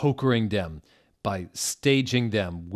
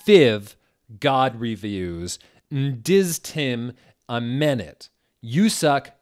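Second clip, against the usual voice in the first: louder, defiant rant; angry author rant; 4 semitones higher, 6 dB louder than usual. Acted. Author ranting at readers in a note. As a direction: scold the flamers.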